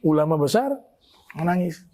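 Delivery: usual speaking voice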